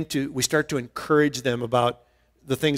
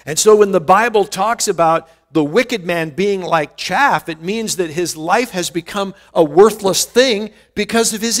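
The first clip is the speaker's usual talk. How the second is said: raised, fervent preaching voice; louder, rising preacher's emphasis; 5 semitones higher, 5 dB louder than usual. Acted. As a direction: drive the point home from the pulpit. Preaching the meaning of chaff.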